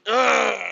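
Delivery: high strained grunt; straining grunt. Retold or imitated. Brother baring his teeth with fists up, high strained grunt. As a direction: grunt like he's straining hard. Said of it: "He goes".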